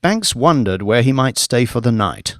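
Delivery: ordinary delivery, nothing marked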